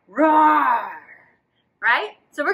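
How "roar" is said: a mild playful roar. Shown as a demonstration. Teacher showing kids a roar. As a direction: give a friendly little roar. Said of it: "how you could make a roaring sound".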